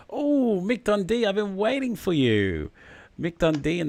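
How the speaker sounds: playful high-pitched greeting. Pretend. Host greeting an awaited viewer, playfully high-pitched. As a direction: coo it high, stretched out for effect.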